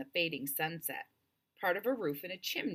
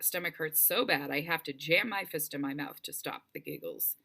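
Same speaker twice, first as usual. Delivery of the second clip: plain reading voice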